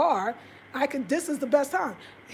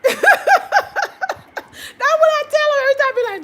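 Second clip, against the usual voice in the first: laughing; 8 dB louder, much faster than usual. Real, not acted. Cracking up after giving up.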